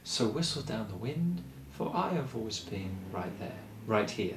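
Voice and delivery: hushed calm spoken line; calm and soft. Shown as a demonstration. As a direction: speak it softly and calmly. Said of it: "say that in that calm kind of way".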